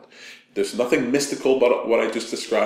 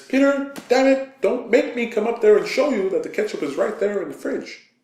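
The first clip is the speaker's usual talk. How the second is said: much higher, loud, exasperated scolding; annoyed girlfriend; 12 semitones higher, 4 dB louder than usual. Retold or imitated. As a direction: scold him like she's had enough. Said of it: "She says".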